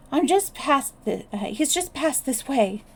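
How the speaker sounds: high, breathless cry of alarm. A narrator's reading. Virginia breathlessly reporting the fleeing man to Fish. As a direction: gasp it out, out of breath. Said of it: "cried Virginia breathlessly".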